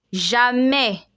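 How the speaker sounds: "Jamais" ending in an eh sound, no S; French open E. Shown as a demonstration. Teacher models the word for learners, careful French pronunciation. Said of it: "This A I S has an E sound".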